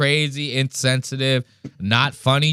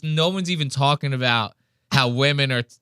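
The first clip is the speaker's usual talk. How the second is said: ordinary talk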